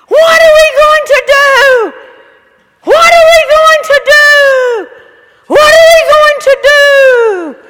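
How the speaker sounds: high, loud shrieking panic, repeated; panicked screaming woman. Retold or imitated. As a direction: mimic a woman running and screaming in terror. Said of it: "she was screaming".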